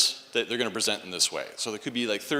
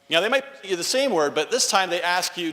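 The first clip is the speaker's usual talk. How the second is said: ordinary talk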